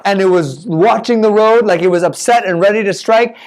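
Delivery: ordinary delivery, nothing marked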